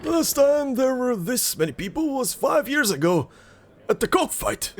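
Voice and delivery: high-pitched villager marvelling at the crowd; impressed villager. Acted. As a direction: play an awed onlooker, pitched well up. Voices a villager in the game.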